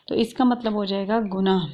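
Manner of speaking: ordinary delivery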